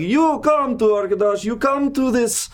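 loud high festive barker call; booming sing song welcome. Acted. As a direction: boom it out like a carnival host.

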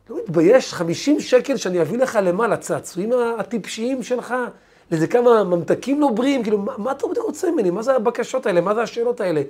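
a dad scolding his son loudly; storyteller acting out a father's angry outburst. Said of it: "זרק עליו קיתונות".

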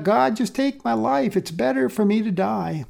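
an understated, high-pitched cry to God. A narrator's reading. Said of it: "again he cried out".

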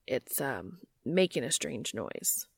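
low, quiet and hesitant; deep voice, unsure of yourself; nervous low voice. Acted. Nervous Cass speaking.

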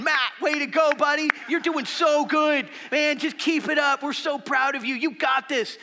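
loud, high, cheering parents by the pool. Retold or imitated. Parents on the side of the pool cheering Matt, loud and high. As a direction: cheer on a struggling kid from poolside chairs. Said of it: "they're sitting there going".

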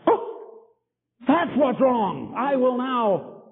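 high, very slow, drawn-out mock realization; dawning realization. Preacher mimicking a listener who finally sees the point, slow and high. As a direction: act out someone finally getting it, slowly. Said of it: "That's what many people need, like".

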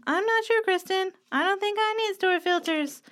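high, whiny skeptic voice; mocking doubtful viewer voice. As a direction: play a reluctant viewer whining. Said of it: "I don't know why you sound that way".

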